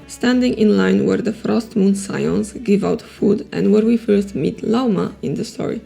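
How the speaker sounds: usual speaking voice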